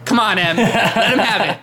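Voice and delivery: loud, high cheering on. Real, not acted. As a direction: egg him on like a fan. Cheering at the video.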